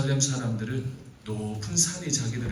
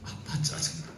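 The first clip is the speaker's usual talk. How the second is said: second take whispered; 3 semitones higher, 6 dB quieter than usual. Shown as a demonstration. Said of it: "다섯 글자예요".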